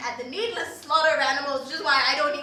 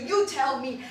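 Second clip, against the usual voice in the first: usual speaking voice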